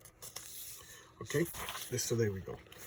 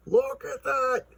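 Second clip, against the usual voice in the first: high-pitched fanboy squeal; delighted squeal over artwork; gushing admiration; 12 semitones higher, 3 dB louder, much slower than usual. Real, not acted. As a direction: marvel at it in a high, thin voice. Host gushes over a drawing.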